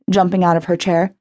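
plain reading voice

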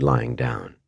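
usual speaking voice

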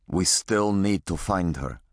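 put-on the dying father speaking with a heavy Russian accent; Russian-accented old father, slightly subdued. A narrator's reading. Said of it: "his voice still thick with a Russian accent".

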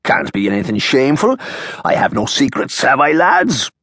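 lightly voiced Irishman grinning to his cronies; Irish barkeep, showing off. McGillicuddy playing to the crowd.

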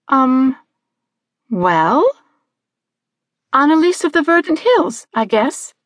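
put-on high, hesitant girl's voice; Annalise. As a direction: guess uncertainly, with long pauses. Girl guesses uncertainly.

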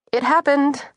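high-pitched, miserable admission. A narrator's reading. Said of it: "I said miserably".

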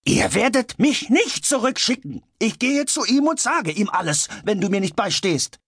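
very high, strained yelling; frantic outburst at the top of the range; wild screaming. A narrator's reading. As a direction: scream the threat in a rage. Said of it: "schrie er".

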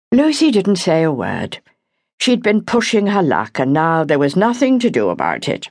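plain reading voice

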